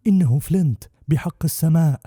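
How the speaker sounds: high, loud panicked shout; Merry screaming in terror at the ghostly voice; terrified cry. A narrator's reading. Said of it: "وصرخ ميري".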